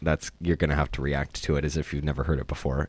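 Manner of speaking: normal talking voice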